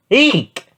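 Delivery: high, loud "eek"; startled squeal. Acted. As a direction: squeal in alarm, high and sudden. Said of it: "the goats called out".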